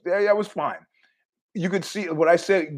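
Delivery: normal talking voice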